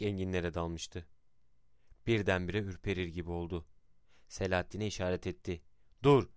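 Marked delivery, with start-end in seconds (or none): 6.0-6.3 sudden loud, high-pitched stop; short narrated shout, raised in pitch; shouted command. A narrator's reading. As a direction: call out an abrupt order to halt. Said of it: "diye bağırdı".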